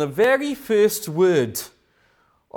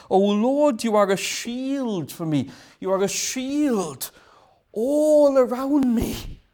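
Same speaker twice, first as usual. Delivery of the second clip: fervent psalm; 6 semitones higher than usual. Acted. Voicing David's psalm.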